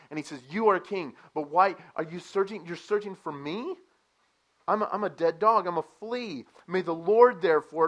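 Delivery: loud, high, pleading sermon delivery; full-force preaching. Acted. Preacher voicing David's humble plea to Saul, loud. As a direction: preach David's words out to the congregation.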